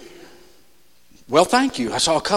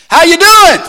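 higher, louder, fake-bright hello; mock cheery greeting; 10 semitones higher, 10 dB louder than usual. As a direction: toss it off like everything's fine.

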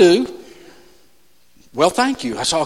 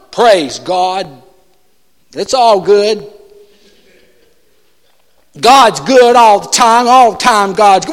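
mock pious reply; 6 dB louder, slower than usual.

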